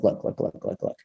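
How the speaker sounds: whispered, talking himself into it; hushed self-talk. Retold or imitated. Man hyping himself up at the computer, whispering. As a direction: breathe look look to yourself. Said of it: "I was like".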